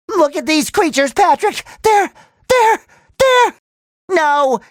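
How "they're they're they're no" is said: high, halting panic; frightened stammer. Acted. Hero sees creatures with Patrick, high panicked stammering.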